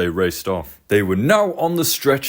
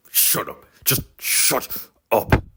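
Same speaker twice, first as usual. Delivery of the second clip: sulky snap; 3 dB quieter, slower than usual. Acted. Sir Handel snaps at taunt.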